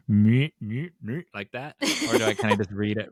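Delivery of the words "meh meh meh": Twin Peaks backwards-talk voice; a droning Twin Peaks Red Room voice. Shown as a demonstration. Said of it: "make it sound like I'm in the Red Room".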